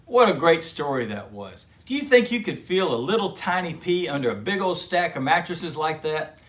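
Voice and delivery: plain reading voice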